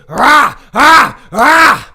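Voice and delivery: full-throated roar, repeated; roaring shout. Shown as a demonstration. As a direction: bellow it like a lion. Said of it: "breathe and scream".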